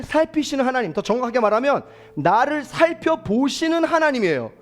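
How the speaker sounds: very high, very loud, impassioned sermon peak; climactic preaching. Acted. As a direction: build the litany to its climax. Climactic preaching over music.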